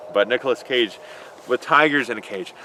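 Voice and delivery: usual speaking voice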